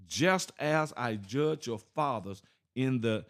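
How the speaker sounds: scripture reading. Acted. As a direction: lay each word down gravely, like scripture. Reads scripture aloud.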